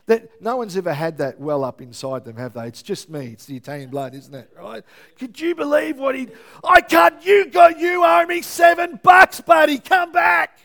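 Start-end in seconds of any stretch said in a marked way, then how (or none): 5.2-10.6 furious shout, far higher and louder; yelling after him. Acted. Pastor yelling at the yahoo who knocked his pie, enraged shout. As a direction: bellow after a stranger who walked off.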